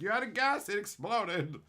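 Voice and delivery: high-pitched mock exclamation; mock alarmed explanation. Voicing an imagined report of an explosion, mock alarm. Imagined excuse after a blowup.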